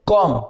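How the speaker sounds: कॉम ending on m; correct comb. Shown as a demonstration. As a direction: say कॉम with the B silent. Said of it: "आप इसको क्या बोलोगे". (कॉम, B silent सही उच्चारण)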